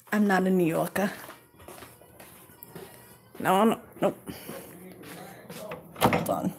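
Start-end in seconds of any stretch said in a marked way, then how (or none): none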